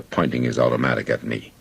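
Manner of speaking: ordinary delivery, nothing marked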